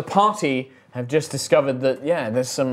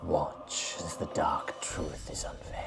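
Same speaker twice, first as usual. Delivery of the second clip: dark, hoarse, slow whisper; spirit whisper; 8 semitones lower, 14 dB quieter, slower than usual. Acted. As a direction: whisper like a ghost inside their heads. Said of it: "this dark, hoarse, feminine voice".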